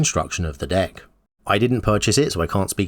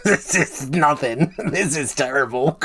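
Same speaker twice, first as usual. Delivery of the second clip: higher, slow, laughing self-critique; laughing at himself; 5 semitones higher, slower than usual. Real, not acted. YouTuber laughing at his own bad impression, slow and amused. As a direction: giggle through it, amused by the flop. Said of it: "That's the worst accent I've ever heard".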